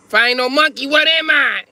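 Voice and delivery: hammed-up offended shriek; outraged squeal. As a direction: pitch jumps way up, loud and indignant. Angry man answering back.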